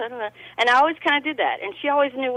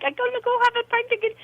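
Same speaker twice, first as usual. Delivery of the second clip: quick, high imitation Irish brogue; mock Irish accent; 12 semitones higher, much faster than usual. Retelling the guest's exit, fast high mock Irish. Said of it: "He's like".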